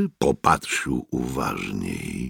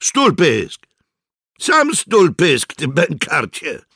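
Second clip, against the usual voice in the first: high, loud quarrelling insults; angry retort; 12 semitones higher, 5 dB louder, slower than usual. A narrator's reading. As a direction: throw the insult straight back. Soldiers trade insults in a quarrel. (gniewna pyskówka)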